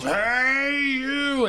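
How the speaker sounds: very high, loud hey you; loud yell. Retold or imitated. Stranger closing the gap on him, high loud yell. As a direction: yell it across the street. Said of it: "And he yells".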